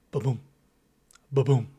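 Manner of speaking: thump it out like a heartbeat; heartbeat sound. Shown as a demonstration. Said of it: "It's your heartbeat".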